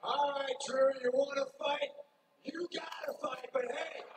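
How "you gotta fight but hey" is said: whispering. Acted. Whispered threat in promo.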